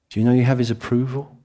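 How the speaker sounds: ordinary talk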